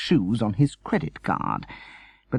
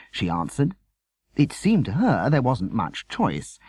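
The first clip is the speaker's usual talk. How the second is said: usual speaking voice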